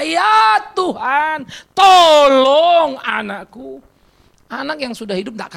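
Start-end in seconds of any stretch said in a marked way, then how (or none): 0.0-3.8 long, loud cry to God; a mother's plea, stretched out high and loud; pleading prayer. Acted. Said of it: "terus berdoa". (seruan doa memohon, panjang dan lantang)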